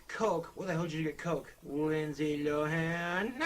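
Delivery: high incredulous dummy; mock the drink as the puppet. Puppet reacts to Coke.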